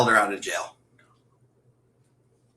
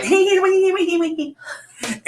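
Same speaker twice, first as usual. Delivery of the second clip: high, squeaky repeated hey; imitating her call. Friend mimicking her quilter pal's excited call, high and squeaky. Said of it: "She's like".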